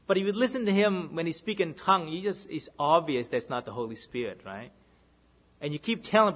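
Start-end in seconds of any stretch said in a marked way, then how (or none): none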